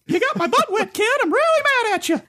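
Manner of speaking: very high squeaky cartoon mouse; Mickey Mouse voice. Mickey scolding kid, voiced.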